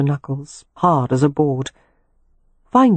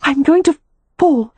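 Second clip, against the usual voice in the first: high, loud breathless cry; 12 semitones higher, 5 dB louder than usual. Acted. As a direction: cry out, nearly losing balance. Aroused woman near collapse.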